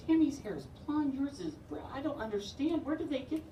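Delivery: high, puzzled lady voice; high and bewildered; confused lady. Retold or imitated. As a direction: work it out aloud like a baffled busybody.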